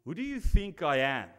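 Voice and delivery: ordinary talk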